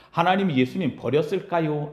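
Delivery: ordinary talk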